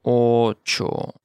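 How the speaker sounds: "Ocho" in two long syllables; slow model. Shown as a demonstration. Teacher modelling vocabulary for learners, slow "Ocho".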